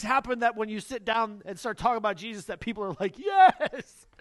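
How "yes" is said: hammed-up cheer, high and loud; mock overjoyed yes; excited yes. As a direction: burst out like you won. Imagined eager listener exclaiming.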